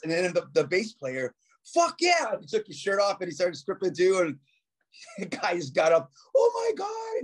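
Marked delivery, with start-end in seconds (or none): 1.7-2.4 high, whooping fuck yeah; excited cheer. Retold or imitated. Bass player joining the naked prank, high excited whoop. 6.4-7.2 very high horrified shriek; mock horrified cry. Homophobic bandmate fleeing the naked band, very high panicked shriek. Homophobic guy flees the room.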